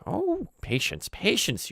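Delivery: high, drawn-out goblin chiding; high old crone voice, slow; old goblin woman. Acted. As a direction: slowly shush him as an old goblin. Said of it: "And she goes".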